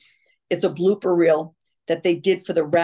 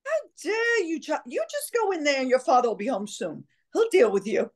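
high-pitched mock-outraged sixties mother; scolding mom; 8 semitones higher than usual. Mom warning children that father will be home, mock scolding. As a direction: scold in character, starting way up high.

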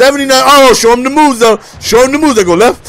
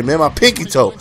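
normal talking voice

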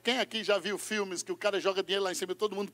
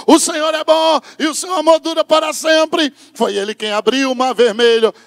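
loud, high, driving sermon; full-force preaching; 4 semitones higher, 16 dB louder than usual. Acted. Pastor leading the church in praise, loud. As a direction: preach it with all your might.

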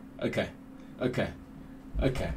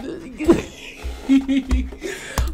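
high, giddy laugh; excited laugh; 10 semitones higher than usual. Real, not acted. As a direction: burst out laughing. Laughing after the reveal.

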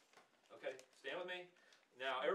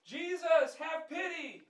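high, loud desperate cry for help; shouted plea; 21 dB louder than usual. Shown as a demonstration. Blind man crying out to Jesus over the crowd, shouting. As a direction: call out over the noisy crowd. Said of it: "Instead, he gets louder".

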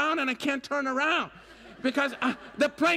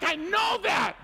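exasperated; 7 semitones higher than usual. Retold or imitated. Frustrated retort to the guide.

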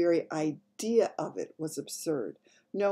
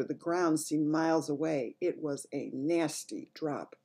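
plain reading voice

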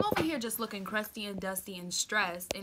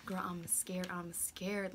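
soft, breath-only retold confession; nervous whisper; 9 dB quieter, much slower than usual. Girl confiding in her friend before going out, nervous whisper.

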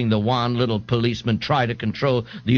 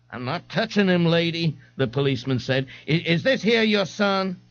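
policeman; 7 semitones higher than usual. Acted. Policeman answers.

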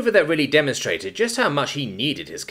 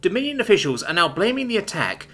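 higher, measured newsreader delivery; news read; 7 semitones higher than usual. Acted. TV news report playing in the living room, newsreader voice.

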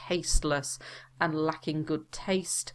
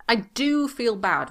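"do" stressed, higher and louder; emphatic do; 6 semitones higher, 4 dB louder than usual. Shown as a demonstration. Teacher modelling emphatic "do" for students, hitting "do". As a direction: punch the word "do". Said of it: "do when we really want to emphasize something".

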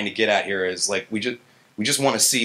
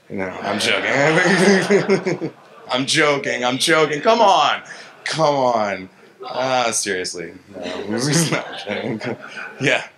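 laughing; 3 semitones higher, 3 dB louder, much slower than usual. Real, not acted. Admits a joke about PhDs.

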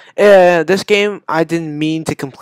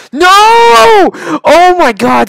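high, loud wail easing into a cry of disbelief; anguished scream; 10 dB louder, much slower than usual. Real, not acted. Gamer failing after seven tries, anguished scream. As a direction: wail at the failure.

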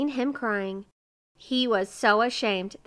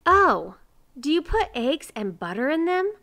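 high, loud oh, then high question; surprised; 7 semitones higher, 6 dB louder than usual. A narrator's reading. Miser learns what goes into pies, surprised. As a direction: ask in wide-eyed surprise.

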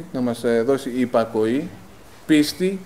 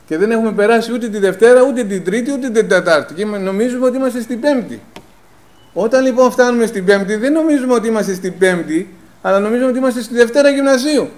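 higher and louder, driving the point home; fiery preaching; 8 semitones higher, 6 dB louder than usual. Acted. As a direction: preach it to the congregation, rising. Preacher builds to a climax.

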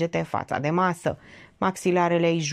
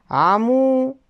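indignant Georgeta; 8 semitones higher, 6 dB louder than usual. Acted. Georgeta butts into the quarrel.

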